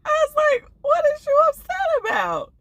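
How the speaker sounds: high squeal of amused disbelief; laughing disbelief. Real, not acted. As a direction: cackle at how mad he looked.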